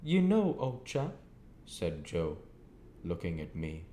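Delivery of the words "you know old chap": Joe to Pip. A narrator's reading.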